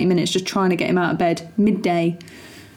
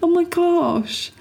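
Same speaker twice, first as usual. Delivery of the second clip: amazed; 10 semitones higher, much slower than usual. Real, not acted. Shocked at huge breakfast.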